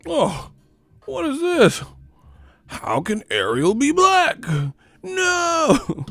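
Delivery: very high, hammed-up indignant voice; squealing fake dismay; mock outraged complainer.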